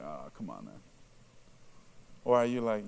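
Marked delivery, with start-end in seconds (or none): 0.0-0.8 very low, soft grumble; low, put-upon groan into words; mock dismissal. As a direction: play someone wearily brushing it off. Mimics dismissive reaction to Jesus loves you.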